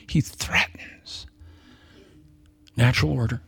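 hushed, whispered warning; sermon warning whispered low. Real, not acted. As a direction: drop to a whisper for weight.

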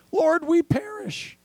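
high, slow cry for help from a sinking boat; drawn-out plea of terrified disciples; desperate cry. Retold or imitated. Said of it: "I love the other version".

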